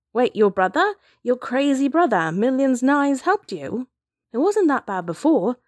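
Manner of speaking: stunned, can't believe what was just heard; incredulous. A narrator's reading. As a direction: blurt it out in rising disbelief. Character reacts in disbelief to revelation.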